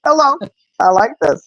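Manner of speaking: high, amused exclamation; delighted surprise. Retold or imitated. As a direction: react with pleased astonishment. Delighted reaction to grandson obeying.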